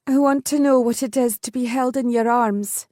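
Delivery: lightly voiced young woman daring to confess; bold but nervous heroine. A narrator's reading. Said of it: "with a boldness she was far from feeling".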